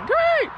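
short, high-pitched woo; bright burst of a whoop; excited whoop. Real, not acted. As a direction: let out a high cheer. Cheering at being in Lakes.

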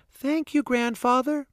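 put-on child's high thank-you; little girl voice. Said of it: "said Charlie".

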